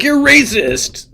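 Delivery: high, drawn-out mock accusation; mock accuser. Critics pouncing on the host, mock accusing. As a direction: stretch it out in a squeal of accusation. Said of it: "people are like".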